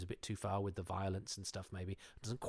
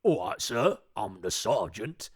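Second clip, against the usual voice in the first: loud, slow, stiff parade-ground sergeant; cliched army sergeant; 8 dB louder, slower than usual. Retold or imitated. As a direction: bark it like a stock TV soldier. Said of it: "your cliched".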